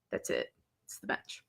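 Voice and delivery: barely voiced, very soft murmur; hushed realization. Student spotting the bench, hushed. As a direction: say it almost under your breath.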